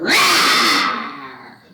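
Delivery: loud, low beastly roar; big growling roar; monster roar. Acted. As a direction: roar like a huge swamp monster. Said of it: "and he roared".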